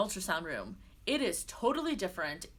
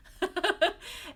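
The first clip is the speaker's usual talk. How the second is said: high burst of giggles; amused laugh; 10 semitones higher, 4 dB louder than usual. Real, not acted. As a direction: crack up at your own slip. Amused by her own slip.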